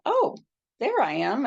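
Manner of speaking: high-pitched "oh" of discovery; pleasant surprise. Retold or imitated. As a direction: retell a little jolt of recognition. Sees own body, retells reaction.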